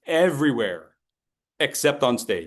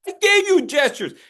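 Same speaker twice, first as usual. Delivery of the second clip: whiny protest; 5 dB louder than usual. Retold or imitated. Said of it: "they're like".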